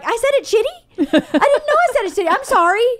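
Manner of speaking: high, questioning mock innocence; retold disbelief, pitch way up; baffled. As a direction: squeak it out as a bewildered apology. Acting her baffled apology.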